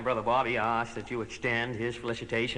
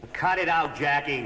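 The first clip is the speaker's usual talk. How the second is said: higher, louder; annoyed; 4 semitones higher, 3 dB louder than usual. Acted. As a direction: snap it out at brother. Brother objects.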